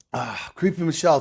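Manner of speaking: usual speaking voice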